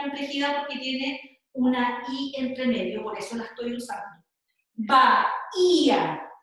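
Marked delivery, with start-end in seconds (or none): 4.7-6.3 "Bahía" very slowly and louder, ba-hí-a with the hiatus apart; separated syllables. Shown as a demonstration. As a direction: stretch "Bahía" into three clear syllables, stress on the í. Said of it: "Lo que hago aquí es separar las sílabas". ("Bahía" muy lento, separando ba-hí-a)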